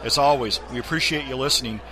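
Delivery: friendly promo voice for the podcast; ad read. Acted. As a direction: pitch the books and DVDs to listeners.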